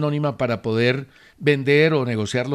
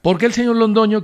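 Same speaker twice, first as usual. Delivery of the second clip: higher, mock-indignant challenge; half-angry reading; 6 semitones higher, 4 dB louder than usual. Host rereading a listener's testy email on air, half-angry. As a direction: put some annoyed tone into the question. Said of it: "yo por eso lo leo con tono".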